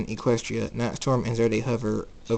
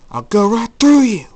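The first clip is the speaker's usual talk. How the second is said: high, very loud battle yell; furious shout; 11 semitones higher, 13 dB louder than usual. Acted. Jacob threatens the one blocking him, shouting. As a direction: charge at them with a raised, forceful shout.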